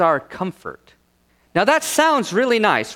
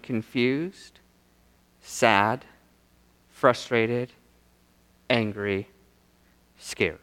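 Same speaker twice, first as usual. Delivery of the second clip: low, subdued list with a pause after each word; somber listing; 8 semitones lower, 6 dB quieter, much slower than usual. Real, not acted. Preacher naming the congregation's own troubles, somber and low. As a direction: let each feeling sink lower and softer.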